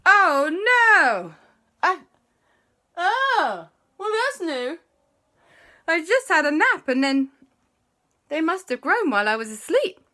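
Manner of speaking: high, loud dismayed voice; shocked Andy. Acted. Andy discovering his wings, high and dismayed.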